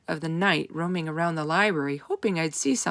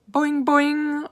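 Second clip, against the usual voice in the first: high, louder twanged frog call; twanging boing; 9 semitones higher, 6 dB louder than usual. A narrator's reading. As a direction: twang it like a spring. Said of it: "Og twanged".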